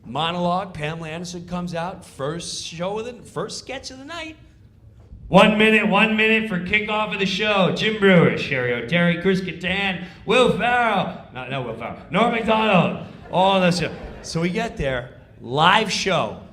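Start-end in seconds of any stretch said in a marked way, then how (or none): 5.3-13.9 stage manager call. Retold or imitated.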